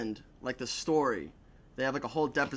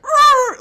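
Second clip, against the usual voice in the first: loud, high engine roar; imitated car roar; 15 dB louder than usual. Storyteller imitating his car speeding past the cop, loud high roar. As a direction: let out a revving screech. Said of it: "and I just, like".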